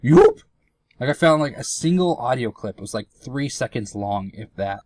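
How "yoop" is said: very high, loud squealed whoop; sudden piercing little squeal; Tingle. Retold or imitated. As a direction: imitate a cartoonish game yelp, rising sharply. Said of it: "It's just like".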